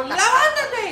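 high, loud laughing command; loud wake-up order. Retold or imitated. Wife ordering her husband awake, laughing and loud. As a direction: bark it to get him up.

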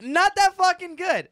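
loud emphatic. Real, not acted. Mocking dad's vape flavor.

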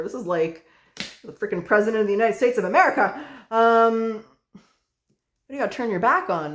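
normal talking voice

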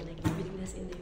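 normal talking voice

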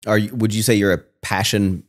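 usual speaking voice

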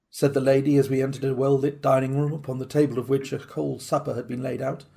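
ordinary delivery

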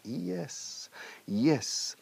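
soft "ee-es" for "Yes"; gentle model of "Yes" with an i onset; Y as Latin I. Shown as a demonstration. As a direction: start "Yes" on a quiet, high ee glide.

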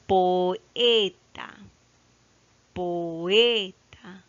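poeta drawn out, loud long E; stress on middle syllable. Shown as a demonstration. Teacher asking where the stress falls, E stressed in poeta. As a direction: stretch and punch the E in poeta. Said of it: "Dónde está la mayor fuerza de voz".

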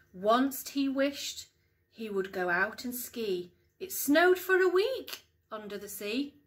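lively storytime voice with big rises on the wishes; bouncy picture-book narration, hushed at the end; reading to children. Acted. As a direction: read it like bedtime storytime for young kids. Mum reads picture book aloud.

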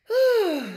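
loud, high sighing "oh"; relieved sigh. Pretend. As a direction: let out a big contented sigh.

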